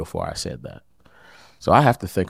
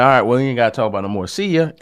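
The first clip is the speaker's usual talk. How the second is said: dismissive brush-off; 5 semitones higher, 4 dB louder, faster than usual. Retold or imitated. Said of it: "So I'll be like".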